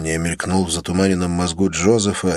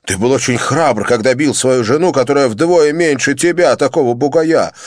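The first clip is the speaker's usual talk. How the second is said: loud, rising taunting challenge; scornful challenge; 8 semitones higher, 5 dB louder than usual. A narrator's reading. Johnny dares Joseph to fight him, scornful. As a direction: dare him to fight a man.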